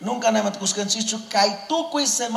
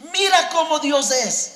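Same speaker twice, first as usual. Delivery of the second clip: raised, higher proclaiming voice; preaching shout; 6 semitones higher than usual. Real, not acted. Preacher exclaiming to the congregation, raised shouting voice. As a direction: call it out to the whole church.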